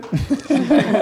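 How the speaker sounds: short high laugh; a quick real chuckle; light laugh. Real, not acted. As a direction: laugh at the friend's joke. Host laughs at timekeeping joke.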